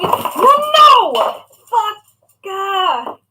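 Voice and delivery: real high, loud shriek of alarm; panicked yell. Real, not acted. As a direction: cry out as disaster strikes. Something almost spills while crafting.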